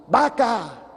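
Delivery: loud, high angry yell back; hammed-up irate yell for a joke; angry shout. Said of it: "se enoja y le grita".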